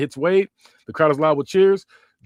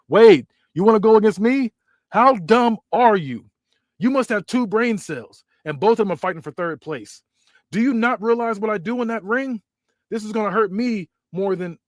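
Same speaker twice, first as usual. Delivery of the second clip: taunting; 6 semitones higher, 4 dB louder than usual. Acted. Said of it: "he says".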